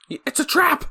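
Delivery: high-pitched imitated warning; mock alarm cry. Host echoing the Princess Leia reference, high mock alarm. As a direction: squeal out a mock warning, far above normal. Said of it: "like, Princess Leia reference".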